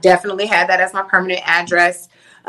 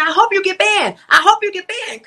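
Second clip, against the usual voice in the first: much higher, taunting jeer; spiteful taunt; 10 semitones higher than usual. Retold or imitated. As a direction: wish it on him with relish. Retelling spiteful wish at him.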